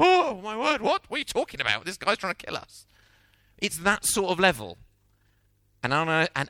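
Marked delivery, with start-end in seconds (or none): 0.0-2.9 high, aghast outburst, then incredulous; a Ukrainian dreaming of praying for Putin, horrified; alarmed disbelief. Pretend. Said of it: "you might feel even more".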